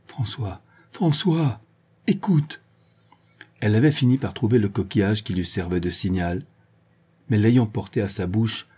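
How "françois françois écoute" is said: appel crié, plus aigu; crier le nom. Acted. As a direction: appeler au loin avec force. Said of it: "toujours en proférant". (appel crié, de plus en plus haut)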